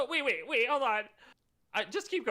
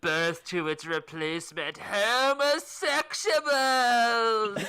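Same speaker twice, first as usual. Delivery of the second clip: very slow, louder, cracking into laughter; grandiose voice of God; 2 semitones higher, 5 dB louder, much slower than usual. Acted. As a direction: proclaim it, breaking up at the last word. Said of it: "I'm just gonna read all these lines".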